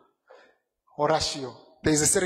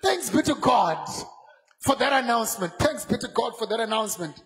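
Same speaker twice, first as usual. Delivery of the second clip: full-force preaching; 3 semitones higher than usual. Acted. Preacher stirring up brothers and sisters, full-force shouting. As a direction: lift the church, shouting praise.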